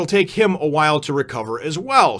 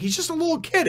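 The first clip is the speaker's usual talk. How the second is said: high-pitched protest. Retold or imitated. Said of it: "And they're like".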